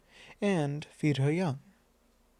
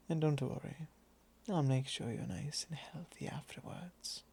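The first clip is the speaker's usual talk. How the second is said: soft reassurance; 9 dB quieter than usual. Acted. Reassurance after the threat.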